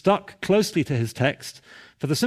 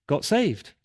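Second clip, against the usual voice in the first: usual speaking voice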